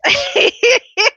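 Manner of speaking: sudden squealing burst of laughter; high laugh. Real, not acted. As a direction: let out a high, loud laugh. Laughing at viewer's teasing.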